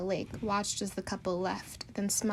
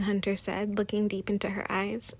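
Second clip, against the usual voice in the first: ordinary delivery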